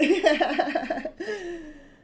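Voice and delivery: high, loud burst of laughter; real laugh after the punchline; big laugh. Real, not acted. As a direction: laugh hard at your own story. Laughs after her retold comeback.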